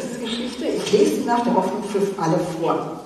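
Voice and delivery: ordinary delivery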